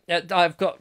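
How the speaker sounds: normal talking voice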